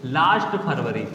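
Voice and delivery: ordinary talk, nothing marked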